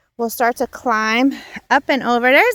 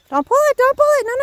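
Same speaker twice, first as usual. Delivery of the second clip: alarmed warning; 13 semitones higher, 3 dB louder, much faster than usual. Real, not acted. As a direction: mom calling out to stop her daughter from pulling the plant. Mom stops child pulling plant.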